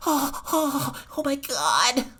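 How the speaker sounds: breathless mock oh my God; lightly hammed-up stunned rival; mock gasping rival. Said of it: "he's like".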